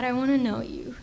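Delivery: ordinary talk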